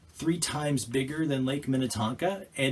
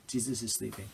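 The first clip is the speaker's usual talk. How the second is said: softly, lower, almost a lullaby; hushed; 4 semitones lower, 9 dB quieter, faster than usual. A narrator's reading. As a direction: drop right down and hush it. Repeats sleeping line softly.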